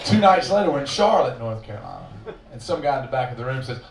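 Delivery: ordinary talk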